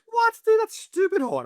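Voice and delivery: high, loud yelp of disbelief; squealed, hammed-up protest; mock shocked outburst. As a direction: imitate a mate reeling from the heat. Mate's shocked reaction to hot sauce.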